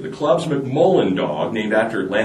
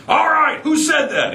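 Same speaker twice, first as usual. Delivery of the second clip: outraged teacher. Acted. Teacher glaring, demanding.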